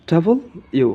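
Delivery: "ডাবল ইউ" as two full words; corrected letter W. Shown as a demonstration. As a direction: separate "ডাবল" from "ইউ" clearly. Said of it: "সঠিক উচ্চারণ হবে". ("ডাবল ইউ" আলাদা দুই শব্দে)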